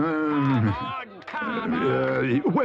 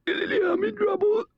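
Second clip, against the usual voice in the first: high squeaky strangled voice; squeaky lost voice; 13 semitones higher, slower than usual. Acted. Toad with a swallowed moustache, squeaky and high. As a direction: squeeze the words out high and thin. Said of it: "lost my voice".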